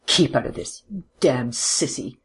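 rough, contained angry retort; seething insult, dragged out at the end; hot anger. A narrator's reading. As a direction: warn him off hotly, without shouting. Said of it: "The Major swung round on him hotly".

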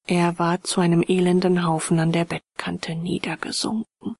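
plain reading voice

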